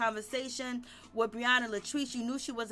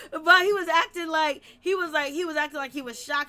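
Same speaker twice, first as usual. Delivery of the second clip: excited; 4 semitones higher, 8 dB louder than usual. Real, not acted. Recap gets loud and excited.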